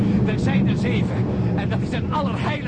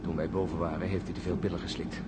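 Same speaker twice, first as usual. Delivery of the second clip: low and bitter; 7 dB quieter than usual. Acted. Peter bitterly blames John.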